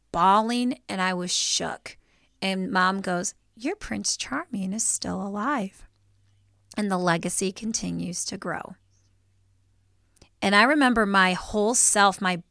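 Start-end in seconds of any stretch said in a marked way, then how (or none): 3.5-8.7 hushed retold comfort; imitating reassuring mom. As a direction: break good news quietly to a child. Mom reassuring bawling child.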